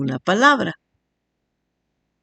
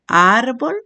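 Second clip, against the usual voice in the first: "Árbol" with a long, raised first syllable; stressed syllable shown; 4 semitones higher than usual. Shown as a demonstration. Teacher showing the tonic syllable, ÁR of "Árbol" stretched. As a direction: lean hard on the ÁR of "Árbol". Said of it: "se pronuncia con mayor intensidad".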